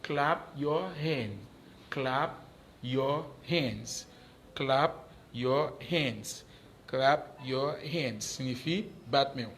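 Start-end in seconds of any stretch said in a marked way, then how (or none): 0.0-1.4 slow model phrase. Shown as a demonstration. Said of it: "w ap di claps your hand". 1.9-4.0 slow model phrase. Shown as a demonstration. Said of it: "w ap di claps your hand". 4.5-8.4 slow model phrase. Shown as a demonstration. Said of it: "w ap di claps your hand".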